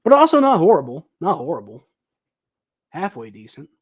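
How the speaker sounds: ordinary delivery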